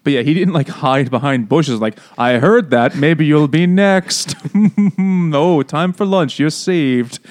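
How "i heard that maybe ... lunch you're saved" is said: slow, loud hammy villain taunt; Bond villain. Retold or imitated. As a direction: ham it up like a Bond baddie. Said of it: "Like a fucking Bond villain".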